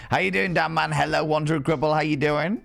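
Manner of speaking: ordinary talk, nothing marked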